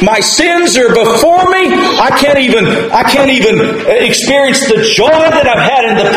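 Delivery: high, pleading lament rising to a cry; David's anguished plea. Acted. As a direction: plead as a lost sinner who feels far from God. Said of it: "David said".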